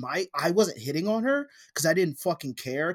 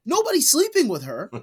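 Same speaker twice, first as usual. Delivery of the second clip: exasperated; 11 semitones higher, 3 dB louder than usual. Retold or imitated. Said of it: "And I was like".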